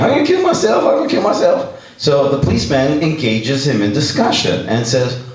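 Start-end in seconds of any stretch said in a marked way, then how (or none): 0.0-1.7 retold screaming. Frantic man yells at the policeman, high mock scream. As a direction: shriek it up like the frantic guy. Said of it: "guy screaming".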